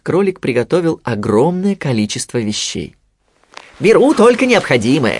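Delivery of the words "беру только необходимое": high, loud rabbit exclamation; exclaiming. A narrator's reading. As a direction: call it out while busy packing. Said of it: "восклицал он". (громко восклицая, голосом кролика)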